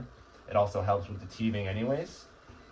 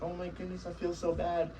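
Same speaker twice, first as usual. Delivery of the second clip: much higher, softer, mock-pitying whine; mock emotional owner; 9 semitones higher, 3 dB quieter than usual. Trainer mocking an owner pitying a crying puppy, high soft mock whine. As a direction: ham up the owner melting with guilt. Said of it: "you get all emotional and you're sad and you're like".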